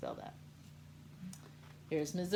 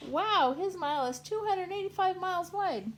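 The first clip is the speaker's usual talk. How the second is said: amazed; 12 semitones higher, 6 dB louder than usual. Acted. Amazed character.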